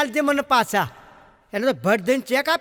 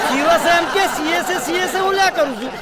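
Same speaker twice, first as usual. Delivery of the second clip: exasperated rant; 2 semitones higher, faster than usual. Retold or imitated. Comic exasperated at the pun.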